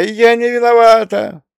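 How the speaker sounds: high, loud, tearful cry; starting to cry. A narrator's reading. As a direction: wail it, on the edge of tears. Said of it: "начиная плакать, выдала".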